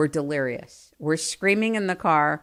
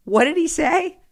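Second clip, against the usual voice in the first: clueless mother; 11 semitones higher than usual. Retold or imitated.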